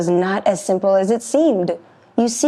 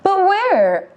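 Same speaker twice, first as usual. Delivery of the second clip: high goat voice; 11 semitones higher than usual. Acted. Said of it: "asked the middle brother".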